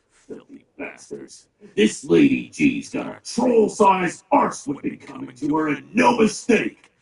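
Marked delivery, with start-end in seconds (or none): 0.1-1.5 disgusted mutter. Acted. Orc disgusted by the event.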